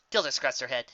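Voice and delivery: ordinary delivery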